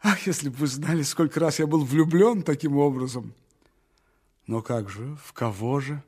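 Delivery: ordinary delivery, nothing marked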